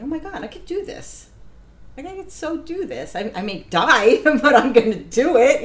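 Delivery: high, then loud, laughing triumph; thrilled disbelief. Retold or imitated. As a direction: burst out, half laughing, I can do this. After longest training run.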